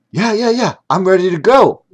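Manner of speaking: put-on high, bright, eager boy; a little boy bursting to start; eager kid voice. A narrator's reading. William eager for his magic lesson, high excited kid. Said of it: "William said".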